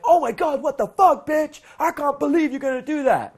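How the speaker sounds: very high, loud mock freak-out; hammed-up screaming rant; mock hysterical outburst. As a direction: shriek it like losing control. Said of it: "You're not like".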